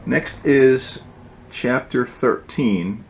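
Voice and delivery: ordinary delivery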